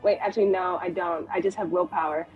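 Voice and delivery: usual speaking voice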